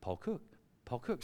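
very soft, low interjection; small, quiet, hopeful reminder; meek persistent prompting. Retold or imitated. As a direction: murmur the name as a nudge. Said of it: "fed up with me going".

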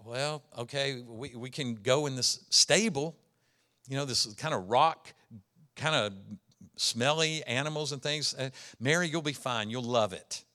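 lightly voiced nervous husband; sheepish Joseph. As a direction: talk her round with a weak excuse. Joseph sheepishly reassuring Mary.

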